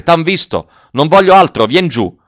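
high, loud urging; urgent shout. A narrator's reading. Officer calling the spotted boy down, shouting. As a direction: insist loudly, pitched well up. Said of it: "gridò l'ufficiale".

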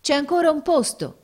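high, loud call to the passengers; grido dei marinai. A narrator's reading. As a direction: shout it out as a sailor. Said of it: "gridarono allora i marinai". (grido acuto dei marinai)

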